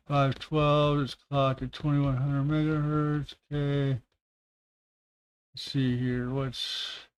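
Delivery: soft, slow murmur while searching; reading to himself. Real, not acted. Streamer scanning specs for viewers, murmuring to himself. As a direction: read specs half to yourself, softly.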